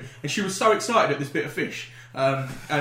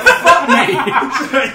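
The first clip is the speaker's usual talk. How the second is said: loud, high laughing "fuck me"; shocked exclamation; 9 semitones higher, 13 dB louder than usual. Retold or imitated. Man retelling shock at a huge shrimp, loud and laughing.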